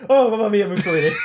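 high, loud hammed-up Italian Oh, mamma mia; mock Italian exclamation. Host riffing on Mussolini's failure, hammed-up Italian cry. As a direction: belt it like a cartoon Italian.

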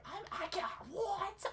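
high, loud mock panic; hammed-up fear. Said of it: "Yo, I am afraid".

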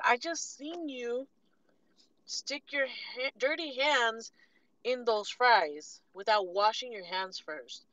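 slow, pointed accusation; slow accusing. Retold or imitated. Calling out the worker's dirty hands, slow and pointed. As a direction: spell out every word at him.

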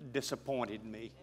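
usual speaking voice

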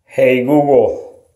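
normal talking voice

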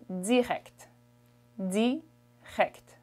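Canadian French direct with a buzzing dz, dzirect; Quebec style direct, buzz the D; Canadian French accent. Shown as a demonstration. Teacher showing how French Canadians say direct. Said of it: "the way a Canadian French person would say this is".

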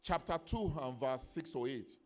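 loud, high, emphatic pulpit delivery; full-force preaching. Real, not acted. Preacher teaching the church about the Holy Ghost, full-force preaching. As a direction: drive each phrase home to the congregation.

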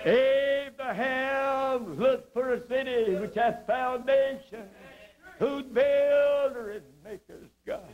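slow, high scripture intoning; slow high preacher's cadence; preaching. Acted. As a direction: lift the verse like a preacher.

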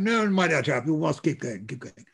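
put-on a light plummy upper-class English accent; plummy English officer accent. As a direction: imitate a plummy English brigadier urging his men on. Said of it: "with a very plummy accent".

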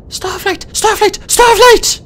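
frantic high-pitched hissed alarm; panicked rush. A narrator's reading. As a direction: urgently call a name, building in panic. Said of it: "whispered in a panicked rush".

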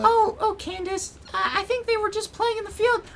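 put-on squeaky falsetto boy; high cartoon kid voice. Boy greeting his sister in falsetto.

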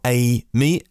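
"A M E" split up as ay, me; alternative pronunciation. Shown as a demonstration. Host offers another way to say the car's name, alternative pronunciation. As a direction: try "A M E" as two separate parts, AY and ME. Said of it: "Do I pronounce it".

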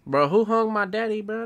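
high, loud shocked question; shocked outcry. Real, not acted. Streamer reacting to his character's father being hanged, shocked shout. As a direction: blurt it out in disbelief.

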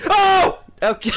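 high startled shout then nervous laugh; startled yelp. Real, not acted. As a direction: jump out of your skin. Jump scare in horror game.

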